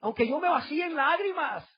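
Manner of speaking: high, slow plaintive pleading to God; pleading worshipper. Retold or imitated. Unfaithful husband begging God to accept his offering, high pleading. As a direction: plead like a man whose offering is refused. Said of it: "Mas diréis".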